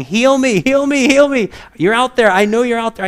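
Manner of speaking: loud, high begging of a blind man; hammed-up pitiful cry for help; pathetic pleading. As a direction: act out a desperate beggar calling out. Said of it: "pathetic, and saying".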